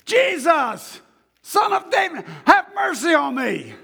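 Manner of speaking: high desperate shout for mercy. Acted. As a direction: blind beggar shouting to Jesus for mercy. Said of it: "he began to shout".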